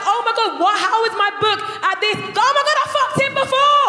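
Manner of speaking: the penny drops, panic rising; shocked shriek. Retold or imitated. Realising she already slept with him, shocked shriek.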